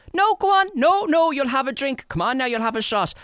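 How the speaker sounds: high, loud Irish cajoling; hammed-up insistence on a drink; pushy party host. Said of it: "People are so like".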